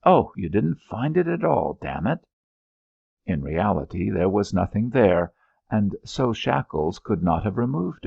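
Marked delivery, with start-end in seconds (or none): 0.0-2.2 angry. Acted. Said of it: "Then he said angrily".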